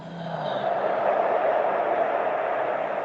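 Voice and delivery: long, breathy release sigh; long relieved sigh. Real, not acted. Stretching tense shoulders, a long sigh of relief. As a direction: breathe the tension out.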